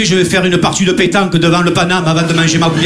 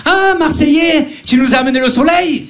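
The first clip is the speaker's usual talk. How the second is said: gushing saleswoman; 6 semitones higher than usual. Retold or imitated. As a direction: imitate a shop assistant cooing at a Marseillais. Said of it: "Une vendeuse a entendu mon accent". (imitation de vendeuse, voix haut perchée)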